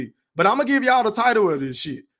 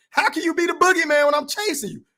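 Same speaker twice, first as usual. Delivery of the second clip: a light, higher-pitched take on the boxer; lightly voiced Crawford taunting his opponent; a hint of Crawford mocking a rival, pitched up; Terrence Bud Crawford voice; 9 semitones higher than usual. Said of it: "in my Terrence Bud Crawford voice".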